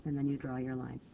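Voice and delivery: ordinary delivery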